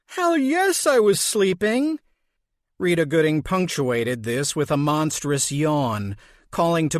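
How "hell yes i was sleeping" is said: high, put-out groan; an exasperated mother snapping back; grumpy mother. Acted. Mother woken at dawn answers her daughter, exasperated.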